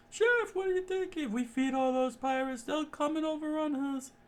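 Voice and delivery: very high, anxious falsetto; high worried chef. Acted. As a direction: fret in a squeaky falsetto.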